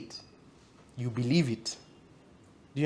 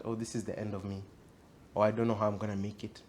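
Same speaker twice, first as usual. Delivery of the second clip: low, softer voice of giving up; defeated inner thought; 4 semitones lower, 8 dB quieter, faster than usual. Acted. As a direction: murmur a despairing thought to yourself. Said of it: "don't give in to the thought".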